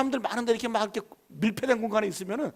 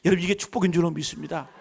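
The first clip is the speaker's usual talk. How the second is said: ordinary talk, nothing marked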